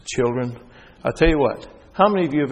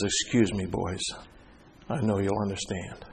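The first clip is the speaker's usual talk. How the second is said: much softer, lower, frail; dying teacher's apology; 3 semitones lower, 8 dB quieter than usual. Retold or imitated. Dying dean excusing himself to his students, softly. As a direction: speak weakly to the class.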